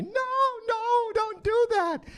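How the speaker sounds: drawn-out, loud falsetto plea; very high mock-mother protest; ventriloquist's mom voice. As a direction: squeal it slowly like a ventriloquist's dummy. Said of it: "that would cause her to say".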